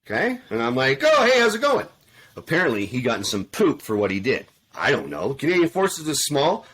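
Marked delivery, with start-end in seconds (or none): 1.0-1.9 high, loud, overfriendly hello; mock-cheery greeting. Running into the old warrant again, mock-cheery. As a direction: greet them with put-on chirpy surprise.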